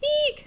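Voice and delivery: very high squealing "Eek"; shrill mock fright squeal; excited squeal. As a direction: squeal like you just saw a snake. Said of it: "I'm excited when I say".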